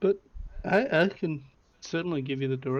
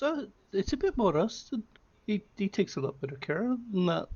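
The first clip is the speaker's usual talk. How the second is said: higher, knowing shopkeeper voice; Minerva's voice; 7 semitones higher than usual. Acted. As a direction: lift the pitch, examining the axe closely. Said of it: "She looks closer and goes".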